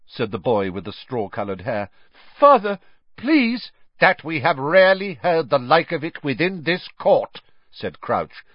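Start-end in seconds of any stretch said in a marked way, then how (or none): 2.4-3.7 put-on high, loud begging boy; desperate plea. A narrator's reading. Accused son pleading with his father. 4.0-7.4 raised, high courtroom voice; speaking more loudly. A narrator's reading. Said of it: "speaking more loudly".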